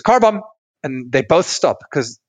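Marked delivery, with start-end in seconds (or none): 0.0-0.4 sudden loud call-out; call out car bomb. Retold or imitated. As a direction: blurt it out to stop the fight. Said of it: "the first person to realize goes".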